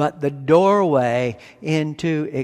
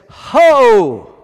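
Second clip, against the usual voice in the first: a loud, high, ringing "Ho!"; emphatic call; 7 semitones higher, 12 dB louder than usual. Retold or imitated. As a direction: call out "Ho!" to grab the crowd's attention. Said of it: "And that's the way he said it".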